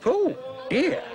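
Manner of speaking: put-on high, laughing old-lady "oh dear"; amused granny. Granny laughing at the fallen curtain, high and amused. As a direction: chuckle kindly at the mishap. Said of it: "says Granny".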